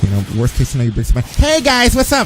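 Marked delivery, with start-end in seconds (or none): 1.3-2.3 put-on high, bright kid greeting a stream. A six-year-old joining the stream, high kid voice. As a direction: pitch it up like a young kid saying hi. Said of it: "the guy's six".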